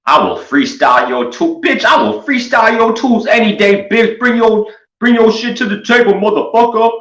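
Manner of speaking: very high, squeaky trash talk; mock the tool guy in a squeaky whine; squeaky taunt. Taunting the toolbox YouTuber in a squeaky high voice.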